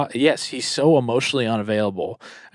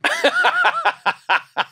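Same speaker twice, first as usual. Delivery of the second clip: squealing burst of laughter; high squealing laugh. Real, not acted. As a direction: shriek with laughter. Bursts out laughing about Rossi.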